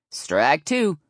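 loud strike call, swooping up; umpire's announcement. A narrator's reading. Umpire calls the second strike.

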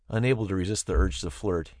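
ordinary delivery, nothing marked

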